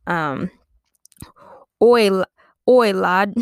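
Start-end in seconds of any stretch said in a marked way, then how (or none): 1.8-3.3 loud mock-British hail; bad British accent. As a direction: call out as a hammed-up English lad. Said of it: "I can do a better British accent".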